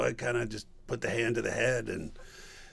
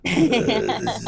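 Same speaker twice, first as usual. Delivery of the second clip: louder growled monster voice; Eruptor voice; 13 semitones lower, 6 dB louder than usual. Shown as a demonstration. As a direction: talk like a hulking lava beast. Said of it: "he talks like this".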